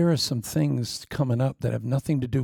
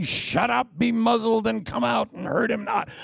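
high commanding rebuke; Jesus rebuking; 8 semitones higher than usual. Retold or imitated. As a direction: cast them out firmly. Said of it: "And Jesus saying".

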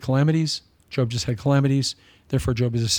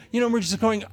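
ordinary delivery

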